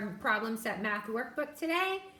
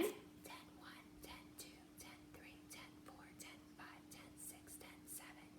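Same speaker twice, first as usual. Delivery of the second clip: whispered counting; 23 dB quieter than usual. Shown as a demonstration. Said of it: "whisper count as you do it".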